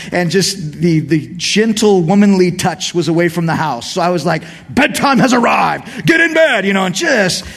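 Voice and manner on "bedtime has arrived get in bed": mock-stern parent command; stern dad voice. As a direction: play the frustrated father at bedtime. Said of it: "talking in a non-Jesus kind of voice".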